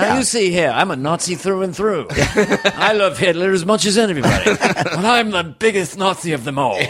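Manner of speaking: a British actor playing a Nazi, no German at all; British accent. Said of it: "British actors who don't change accent".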